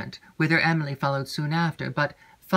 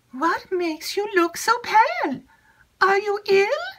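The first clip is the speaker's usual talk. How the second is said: put-on much higher, eager young maid's voice; chattering maid Annette. Maid Annette eagerly seeking Emily, high excited chatter. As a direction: gush it out high and breathless with news.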